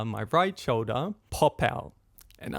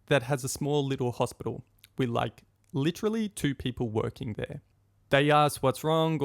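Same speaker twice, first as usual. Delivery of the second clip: normal talking voice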